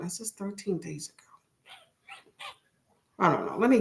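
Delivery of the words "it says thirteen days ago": breathy whisper to herself, quickly; whispered aside. Real, not acted. Streamer checking an old live stream link, whispered. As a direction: read it under your breath, faster. Reading to herself while searching.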